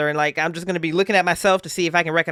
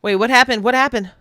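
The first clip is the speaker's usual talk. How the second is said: alarmed; 6 semitones higher, 5 dB louder than usual. Real, not acted. Alarmed player asking what happened.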